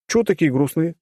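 put-on casual cheerful young man; breezy teasing; teasing captor. A narrator's reading. Captor teases prisoners.

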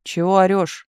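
mildly angry retort; angered. A narrator's reading. Chief rebuking the telegraph operator, mildly angry. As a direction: push back with a touch of anger. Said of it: "озлился начальник".

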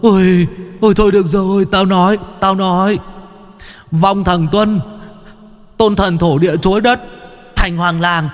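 high, pained wailing plea; pleading ghost. A narrator's reading. Tortured one-eyed ghost begs and confesses, high wailing. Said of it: "nó giãy giụa van xin".

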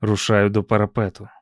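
plain reading voice

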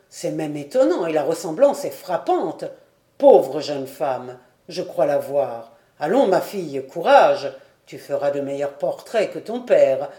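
voix grave et forte, chaleureuse et encourageante; vieux docteur ému. A narrator's reading. (le docteur félicite Diane, voix grave et émue)

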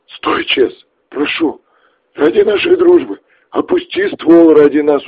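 high, frantic pleading; desperate begging. Acted. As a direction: beg him to stop. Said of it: "взмолился Макс".

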